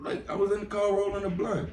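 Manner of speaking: high indignant protest; flustered defense. Retold or imitated. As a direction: plead innocence, flustered.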